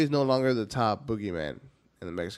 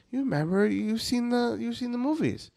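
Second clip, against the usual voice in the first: high mom voice, pitched up; imitating mom; 12 semitones higher than usual. Mom reminding her son about the movies, high mock voice. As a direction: play his mother reminding him, voice raised high. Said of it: "but she's like".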